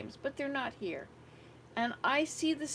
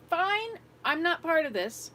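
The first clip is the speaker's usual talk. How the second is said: higher, louder fed-up dismissal; exasperated giving up; 7 semitones higher, 7 dB louder than usual. Retold or imitated. Grandmother retelling giving up on the tantrum, fed-up dismissal. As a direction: throw up your hands at her.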